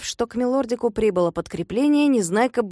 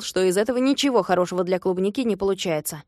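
ordinary delivery, nothing marked

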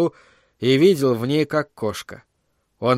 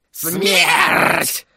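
high, drawn-out cry; parrot shriek; 4 semitones higher than usual. A narrator's reading. Both parrot heads crying in chorus, shrieking. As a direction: shriek the single word like a parrot. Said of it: "вскрикнули хором обе головы попугая".

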